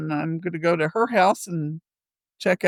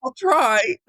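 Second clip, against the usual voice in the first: meek promise; 6 semitones higher than usual. Retold or imitated. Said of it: "I'm like".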